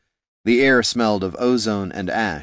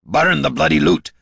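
loud, higher shout; shouting back; 8 semitones higher, 6 dB louder than usual. Acted. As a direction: bellow it over your shoulder. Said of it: "Gorm shouted back to them".

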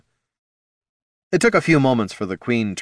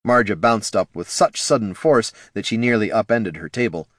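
ordinary delivery

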